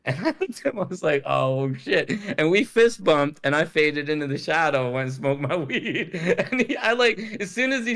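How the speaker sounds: cracking up as you tell it; laughing. Real, not acted. Laughing while retelling the moment.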